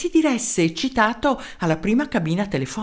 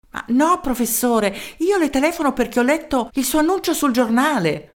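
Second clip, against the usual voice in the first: a higher, eager mayor's voice; 6 semitones higher than usual. Acted. Mayor introducing himself to the professor, eager. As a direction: introduce yourself keenly down the phone.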